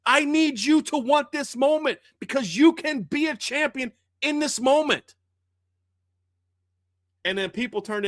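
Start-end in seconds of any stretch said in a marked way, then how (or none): 0.1-5.2 fired-up coach's rally cry; coach yelling encouragement. Retold or imitated. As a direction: push her to want it. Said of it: "That's what she's yelling at her".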